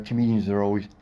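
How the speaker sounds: ordinary talk, nothing marked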